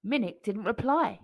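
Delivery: usual speaking voice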